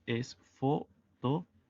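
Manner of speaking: photographe said slowly in French; slow French model. Shown as a demonstration. Teacher slowly models photographe for Spanish speakers. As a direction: draw out photographe carefully. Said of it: "La pronunciación".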